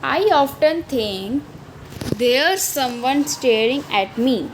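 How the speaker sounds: put-on very slow, each word laid out for children; slow poem recital. Teacher reading the child's line slowly to her class. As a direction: read the verse slowly, word by word.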